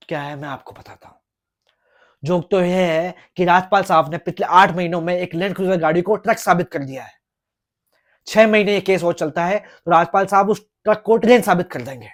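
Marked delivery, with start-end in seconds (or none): 2.2-12.1 loud, scornful build; mock the other lawyer louder and louder; rising mocking tirade. Lawyer ridicules Rajpal's tricks before the judge, loud and scornful. Lawyer mocks opposing counsel.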